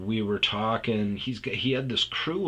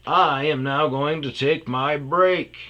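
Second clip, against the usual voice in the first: slow, louder, higher decree; mock-stern boss; 6 semitones higher, 8 dB louder than usual. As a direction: announce it like an official rule. Owner's grand announcement.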